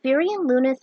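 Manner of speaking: plain reading voice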